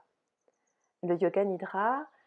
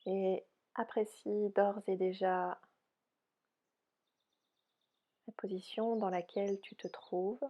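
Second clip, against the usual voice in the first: soft, unhurried yoga nidra guidance; softly spoken relaxation voice, spacious phrasing; guided meditation; 4 dB quieter than usual. Real, not acted. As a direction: guide them gently into rest, with long pauses.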